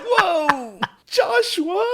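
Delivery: very high, loud squeal of alarm; mock shock. Viewer reacting to the father's betrayal, shrill mock shock.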